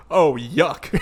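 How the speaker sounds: understated mock disgust; deadpan imitation of mild distaste; super calm. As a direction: shrug off the horror in an unbothered voice. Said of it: "it was super calm".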